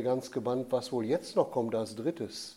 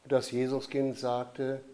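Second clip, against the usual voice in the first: ordinary delivery, nothing marked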